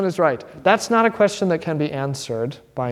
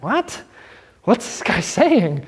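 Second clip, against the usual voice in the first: brother's amused disbelief; 3 semitones higher, slower than usual. Retold or imitated. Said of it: "He's like".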